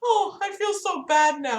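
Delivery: put-on high-pitched apologetic old lady. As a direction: imitate a frustrated older caller apologizing in a high voice. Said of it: "it was just this old lady".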